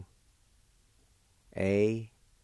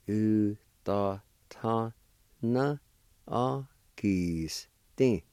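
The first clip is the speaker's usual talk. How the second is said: slow joined demo; much slower than usual. Shown as a demonstration. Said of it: "And together".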